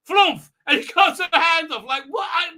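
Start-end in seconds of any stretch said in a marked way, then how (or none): 0.1-0.5 loud, high "flumph"; punchy mock sound effect of a chop; imitated chop noise. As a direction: blurt the chop noise loudly. Imitates the hand being cut off.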